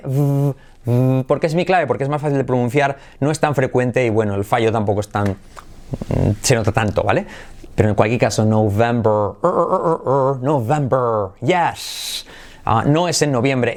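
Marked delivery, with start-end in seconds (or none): 0.0-1.2 "v" as a held voiced buzz; uve inglesa. Shown as a demonstration. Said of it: "letra semiclave V". 8.4-11.3 "November" with the final "er" drilled over and over; erre inglesa final. Shown as a demonstration. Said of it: "para pronunciar la letra clave R".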